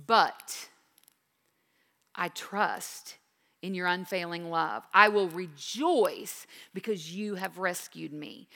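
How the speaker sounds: hushed reverent reading. Acted.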